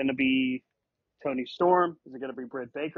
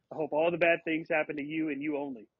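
normal talking voice

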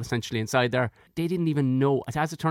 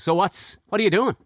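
higher, louder cop grilling; cop mode; 7 semitones higher, 5 dB louder than usual. Retold or imitated. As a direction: interrogate like a policeman. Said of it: "pure cop mode".

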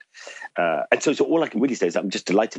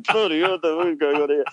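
high, startled "bloody hell" in jest; mock alarm; 10 semitones higher than usual. Patient imagining the vet at his surgery, high mock alarm. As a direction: pitch it up in playful panic.

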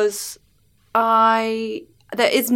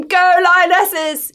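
cheerleader; 7 semitones higher, much slower than usual. Retold or imitated. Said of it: "you're the sort of cheerleader".

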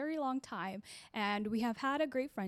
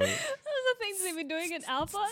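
high, laughing hard while talking; 10 semitones higher, 3 dB louder than usual. Real, not acted. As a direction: laugh through the words.

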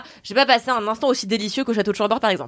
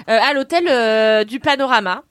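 normal talking voice

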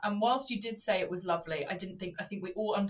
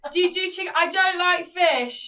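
put-on high, loud drunken demand; 8 semitones higher, 8 dB louder than usual. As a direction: badger the waitress like a drunk. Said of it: "So he's really drunk".

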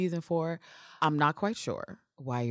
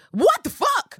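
exasperated shout; 8 dB louder, slower than usual. Real, not acted. Exasperated outburst.

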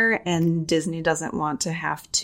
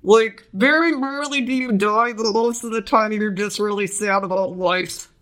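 underwater bubble voice; 3 semitones higher than usual. Acted. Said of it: "I should do the underwater bubble voice".

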